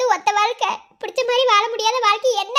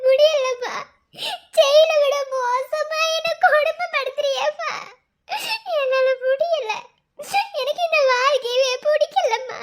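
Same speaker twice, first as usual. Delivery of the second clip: tearful pleading; 3 semitones higher than usual. Acted. Daughter begs her mother to end her suffering, tearful pleading. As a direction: plead through sobs, worn out by misery.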